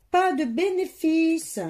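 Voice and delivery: high, slow stress on pas; emphatic complaint. Real, not acted. Grumbling to viewers about never winning, stressing pas.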